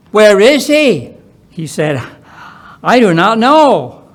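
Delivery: ordinary talk, nothing marked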